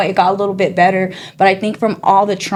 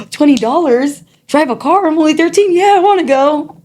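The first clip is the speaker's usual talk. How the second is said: put-on much higher, thrilled young voice; excited kid; 9 semitones higher, 3 dB louder than usual. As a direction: squeal it like a thirteen-year-old offered cash. Kid self thrilled by uncle's offer.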